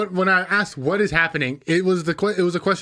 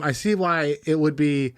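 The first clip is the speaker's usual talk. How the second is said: normal talking voice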